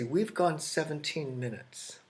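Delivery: normal talking voice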